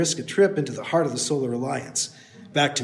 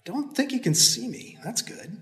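softer, to himself; quiet thought; 6 semitones higher, 6 dB quieter than usual. Acted. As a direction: keep it under your breath. Hero's quiet inner thought.

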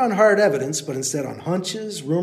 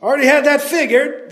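loud, high call; calling back; 10 semitones higher, 7 dB louder than usual. Acted. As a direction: call it up the hill. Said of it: "the man called back".

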